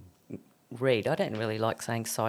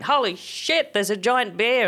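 shocked kangaroo; 8 semitones higher, 4 dB louder, slower than usual. Pretend. Kangaroos voiced in a joke.

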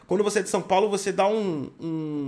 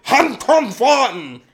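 slow, loud, harsh fake German; mock German accent declaring love; 4 semitones higher, 8 dB louder, much slower than usual. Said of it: "Imagine um alemão falando que ama você".

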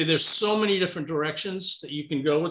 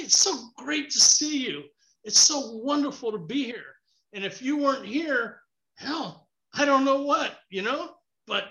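animated mirror pep talk; 9 semitones higher than usual. Retold or imitated. Man hyping himself up in the mirror, wildly animated. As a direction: greet yourself in the mirror like a long-lost best friend. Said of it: "I get very animated about it".

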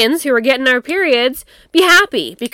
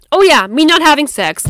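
excited realization; 5 semitones higher, 7 dB louder than usual. Real, not acted. Sudden loud recollection.

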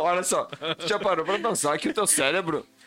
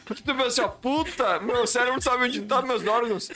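excited; 9 semitones higher than usual. Real, not acted. Stoned excited revelation.

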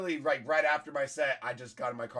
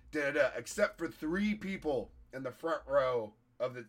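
ordinary talk, nothing marked

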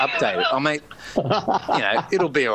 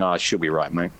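laid-back Aussie; 8 semitones lower than usual. Retold or imitated. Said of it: "we have that saying".

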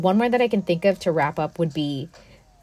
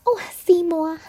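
high breathy whispered Audrey voice; Audrey's little voice; 11 semitones higher than usual. Retold or imitated. Fan doing Audrey's tiny high voice, whispered. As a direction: whisper it high, small and sweet. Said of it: "that actress did such a good job with that voice".

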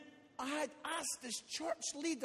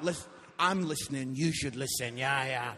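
low, louder comic voice of God; God giving advice; 10 semitones lower, 7 dB louder than usual. Retold or imitated. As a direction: play God hastily urging a husband to obey. God's dry answer after wife's outburst.

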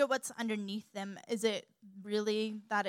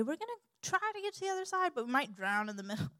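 higher, hurried, wavering mock doubt; unsure; 7 semitones higher, faster than usual. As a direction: hedge it as if not sure at all. Mock unsure version of Jesus' promise.

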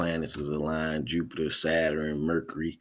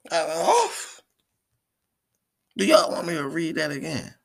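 hyped; 13 semitones higher, 4 dB louder than usual. Real, not acted. Hyped after reaction.

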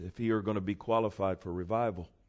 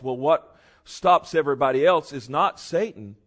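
ordinary delivery

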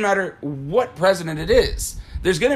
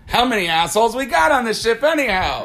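exasperated movie quote; 7 semitones higher, 4 dB louder than usual. Retold or imitated. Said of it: "Gun him his fo-, first clap".

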